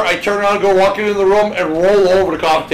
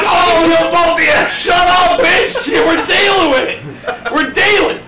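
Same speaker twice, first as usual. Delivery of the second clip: yelling back, laughing; 10 semitones higher, slower than usual. Retold or imitated. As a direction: holler back while laughing.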